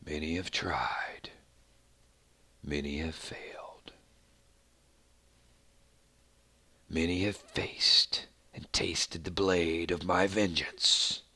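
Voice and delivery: low, slow, whispered threat of vengeance; menacing villain whisper. Pretend. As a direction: breathe it like a dark warlord. Community video, menacing villain voice.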